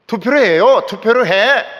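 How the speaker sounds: loud mock quarrel in Seoul speech. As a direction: argue sharply in Seoul speech. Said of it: "이러면서 서울말을 합니다". (서울말로 흉내 낸 말싸움)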